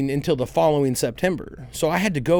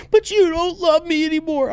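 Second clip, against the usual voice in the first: put-on whiny little girl voice; 13 semitones higher, 4 dB louder than usual.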